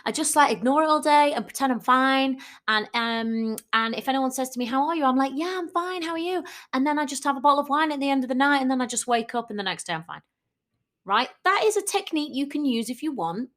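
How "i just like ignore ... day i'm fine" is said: mock carefree chirpy voice, high and quick; breezy denial. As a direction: play someone brushing it all off, upbeat and fast. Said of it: "you might be like".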